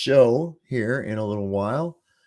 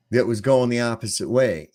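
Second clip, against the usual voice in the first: ordinary talk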